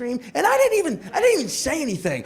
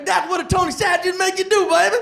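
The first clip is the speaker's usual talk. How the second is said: high, giggly hammed-up delight; mock-advert enthusiasm, laughing and high; gleeful pitch; 8 semitones higher than usual. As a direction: sell it with giddy joy, voice pitched up.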